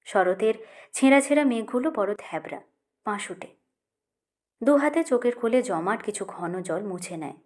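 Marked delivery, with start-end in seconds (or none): none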